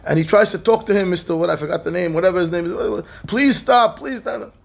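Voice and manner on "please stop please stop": exasperated plea. Retold or imitated. Begging noisy roommate to stop.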